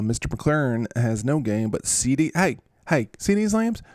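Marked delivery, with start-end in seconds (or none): 2.3-3.8 high, sing-song voice to the lambs; calling lambs in a high voice; excited high call. Real, not acted. Farmer calling to lambs, high and excited.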